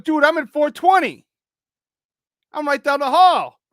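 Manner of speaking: high, loud, alarmed protest; shocked guest learning the overdose is down the hall, high and loud; alarmed disbelief. Retold or imitated. Shocked the OD is nearby.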